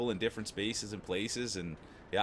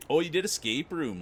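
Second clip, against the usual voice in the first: usual speaking voice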